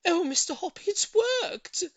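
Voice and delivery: nearly in tears from happiness; a touch of joyful crying; tearful joy. A narrator's reading. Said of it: "She was crying".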